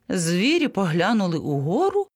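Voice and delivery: usual speaking voice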